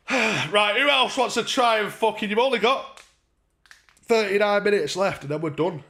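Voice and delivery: rally the chat; calling out. Real, not acted. Streamer calling out to viewers for the last minutes, loud.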